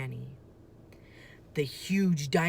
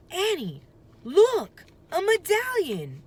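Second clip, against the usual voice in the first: high loud excited calling out; 9 dB louder than usual. A narrator's reading. Jack calling Annie to see the medallion, excited. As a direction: call her over with the find.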